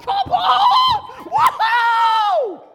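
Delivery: very high, very loud shouting; wild cheering. Pretend. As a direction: celebrate like a wild fan. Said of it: "I think he does this".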